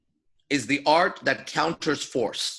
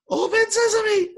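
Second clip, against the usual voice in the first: much higher, louder, slow squeak; squeaky but forced; 5 dB louder, slower than usual. Retold or imitated. Told to say it stronger, still a squeak. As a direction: push hard yet still squeak. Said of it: "Say it stronger".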